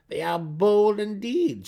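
a proud drow daughter sneering at the enemy; Brisa, cold priestess. Acted. Brisa scorning the attackers to her mother, high and haughty.

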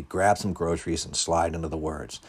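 plain reading voice

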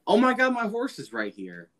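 high-pitched, gushing fake surprise; mock delighted discovery. Friend mocking Gowan finding his horse, fake delight.

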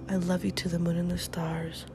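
ordinary delivery

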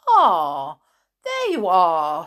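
loud, hearty greeting; Mister Stink. A narrator's reading. Mister Stink greeting Chloe at the shed door, hearty and loud. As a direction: boom out a welcome.

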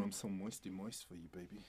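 low, soft, mock-sultry come-on; mock seduction. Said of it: "That's awful".

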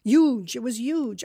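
Jersey "huge" with the h dropped, as "yuge"; emphatic New Jersey accent. Shown as a demonstration. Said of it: "when you're gonna say huge".

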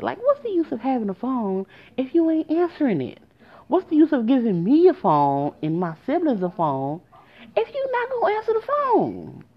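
high-pitched exasperated complaint, rising to a peak; exasperated venting. Real, not acted. Storyteller venting to listeners about unanswered calls, exasperated.

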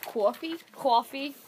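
trying out a Boston "cwoffee"; questioning attempt at a Boston accent. Shown as a demonstration. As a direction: test a Boston accent, rising at the end. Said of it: "a Boston accent".

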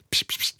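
soft mock hissing pst sounds; imitating hiss. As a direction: hiss pst like the egg man. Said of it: "Und dann hat er immer so pst". (leise nachgemachtes Zischen)

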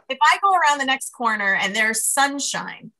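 ordinary delivery

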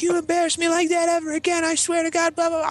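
very high, screeched tirade; enraged mom. Retold or imitated. Mom threatening the kid she is beating, imitated screech. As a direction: rant in a hammed-up furious mom voice.

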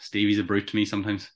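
ordinary talk, nothing marked

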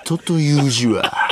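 slow, hammed-up teacher lecture; 武田鉄矢. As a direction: savour it like an impressionist's famous teacher. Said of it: "武田鉄矢みたいにできるやつもある". (武田鉄矢のものまねで、ゆっくり説く)

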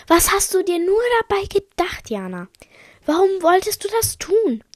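high-pitched indignant reproach; reproachful questioning. Acted. Acted reproach after the attack.

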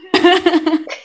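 sudden laugh at herself. Real, not acted. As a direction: burst out laughing mid-story. Laughs at her own story. (risa repentina)

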